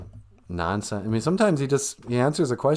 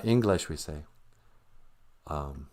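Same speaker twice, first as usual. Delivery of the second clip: ordinary talk, nothing marked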